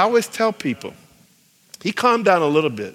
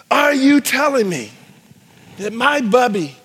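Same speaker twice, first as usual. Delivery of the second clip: high, accusing shout building to the end; acted outrage, erupting on the last words; angry yelling; 8 semitones higher, 4 dB louder than usual. Angry student confronting campus evangelist about grandmother, acted yelling. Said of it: "he started yelling at me".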